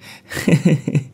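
high, cunning interrupting laugh; scheming woman's sly laugh; sly cackle. Acted. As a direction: cackle in to cut him off. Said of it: "Mụ Linh cười chen ngang".

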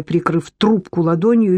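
ordinary delivery

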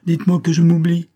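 high, loud, wounded bitterness; bitter outburst. A narrator's reading. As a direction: fling the bitter words at her. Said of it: "s'écria-t-il avec amertume".

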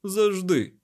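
high, loud interjection; shouted. A narrator's reading. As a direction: cut in with a shout. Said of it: "крикнув Лемюель".

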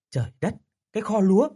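startled gasp of disbelief; surprised. Acted. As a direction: blurt it out in shock. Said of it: "Lâm ngạc nhiên".

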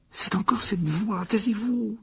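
high, slow, tense warning; urgent hushing. A narrator's reading. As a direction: strain to listen while silencing them. Harry fait taire ses amis. (avertissement tendu, voix aiguë)

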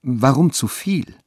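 tentative, stretched-out query; hesitant voice. A narrator's reading. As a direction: draw it out with mild hesitation. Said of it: "sagte sie mit zögernder Stimme".

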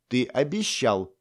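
slow, teasing reminder; playful warning. A narrator's reading. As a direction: pin him to his word. Lana pokes him, warning. (медленно, с игривым нажимом)

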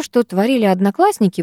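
ordinary delivery

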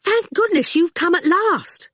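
high, anxious student voice; a flustered young woman, relieved he's home; fretful and high-pitched; Fifi, nervous. A narrator's reading. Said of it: "fidgeting nervously".

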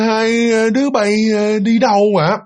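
put-on high, slow, drawn-out old woman's call; feeble old woman. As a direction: call out weakly, stretching every word. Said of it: "là rất là yếu ớt luôn á". (giọng bà già yếu ớt)

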